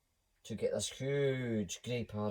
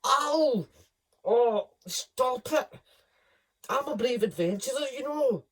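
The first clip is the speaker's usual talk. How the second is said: much higher, louder squealing cub; squealing lion cub; 11 semitones higher, 8 dB louder than usual. Acted. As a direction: squeal out the hurt protest. Lucky complaining in cub voice.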